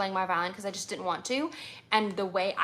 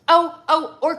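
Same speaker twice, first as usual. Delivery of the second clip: a quick, loud, higher oh of remembering; sudden recall; 8 semitones higher, 12 dB louder, slower than usual. Real, not acted. To her viewers, she suddenly remembers Kreutzer, a loud, higher oh. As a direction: blurt it out as the idea hits.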